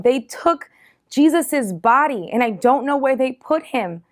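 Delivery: freaking out. A narrator's reading. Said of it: "Mary freaks out".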